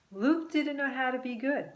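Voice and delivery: usual speaking voice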